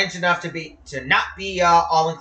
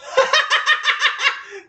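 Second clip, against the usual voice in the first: relieved laugh. Real, not acted. Laughs after close call.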